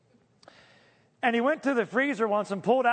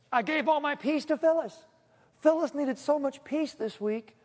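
mock God voice; 8 semitones higher than usual. God voiced, joking about rationed peace.

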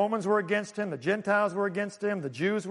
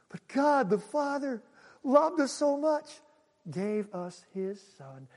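rising awe; 5 semitones higher, slower than usual. Acted. Preacher lifts then drops.

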